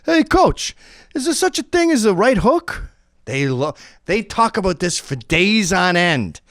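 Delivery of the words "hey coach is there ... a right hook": high eager student voice; eager question. Retold or imitated. Boxer stalling the coach, high eager asking. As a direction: ask it high and keen to stall. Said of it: "question to ask a coach".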